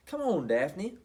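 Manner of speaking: calling out. Acted. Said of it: "Puddle called".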